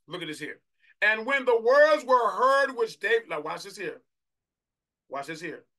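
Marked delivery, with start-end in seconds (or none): 1.0-3.3 loud, high declamation, slowly stretched; slow, full-voiced scripture proclamation; preached scripture reading. Real, not acted. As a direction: proclaim the verse to the whole congregation. Reads scripture at full force.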